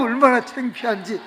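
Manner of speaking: ordinary delivery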